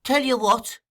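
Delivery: high, querulous question; pettish. A narrator's reading. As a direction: answer like someone woken against their will. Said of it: "shaking himself pettishly".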